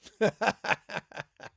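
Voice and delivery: a burst of hearty laughter; loud laugh. Real, not acted. Laugh alone.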